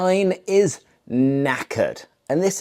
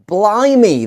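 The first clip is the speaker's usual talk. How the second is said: surprised blimey; 6 semitones higher, 4 dB louder than usual. Shown as a demonstration.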